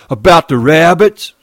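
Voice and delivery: Lenny bursting out about the rabbits; near shout. Acted. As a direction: blurt it out in excitement. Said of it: "Lenny almost shouted".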